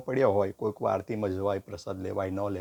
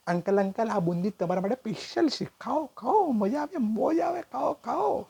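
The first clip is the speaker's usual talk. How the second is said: put-on much higher, slow, sing-song child; cheeky kid voice; 11 semitones higher than usual. Nephew urging uncle to eat, high sing-song child. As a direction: coax the uncle like a sly little boy.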